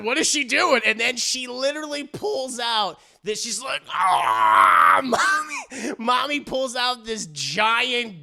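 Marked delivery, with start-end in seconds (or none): 0.0-0.8 higher, louder, startled; gasp, then blurt it in disbelief; shocked onlooker voice; gasping surprise. Retold or imitated. Gasp at Mommy's move. 3.9-5.0 loud war cry; a sudden loud battle yell. Retold or imitated. Mommy pulls a gun.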